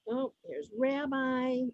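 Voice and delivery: usual speaking voice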